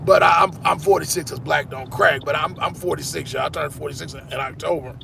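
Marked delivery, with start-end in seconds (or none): none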